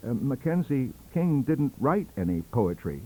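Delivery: ordinary delivery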